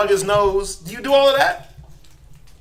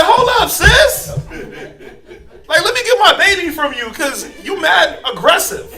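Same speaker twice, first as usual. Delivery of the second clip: laughing protest; 11 semitones higher, 8 dB louder than usual. Acted. Parent taking the baby back from the nurse, laughing protest. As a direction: stop her, laughing in alarm.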